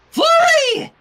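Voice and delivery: high, loud cry of alarm; shouted warning. A narrator's reading. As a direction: call her name in a loud, high panic. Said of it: "Sunset cried".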